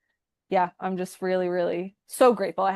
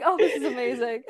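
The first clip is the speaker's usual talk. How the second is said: laughing; 4 semitones higher than usual. Retold or imitated. Quoting own joyful reaction.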